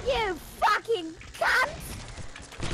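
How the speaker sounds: drawn-out mock insult.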